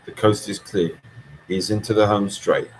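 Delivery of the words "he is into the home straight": usual speaking voice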